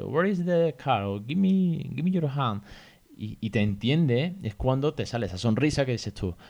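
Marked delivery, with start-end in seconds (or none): 0.0-1.0 higher sing-song voice for a little one; talking to a toddler. Retold or imitated. Parent asking a small child where the car is, in a higher sing-song voice. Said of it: "tú le dices". 1.3-2.6 put-on higher coaxing voice to a baby; talking to a toddler. Parent coaxing a small child to give a hand, higher and coaxing. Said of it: "tú le dices".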